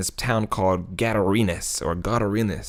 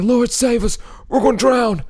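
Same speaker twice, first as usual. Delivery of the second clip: high, loud panicked cry for help; panicked plea; 13 semitones higher, 5 dB louder than usual. Retold or imitated. Disciples begging the sleeping Jesus in the storm, high panicked cry. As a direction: cry out as the boat is going under.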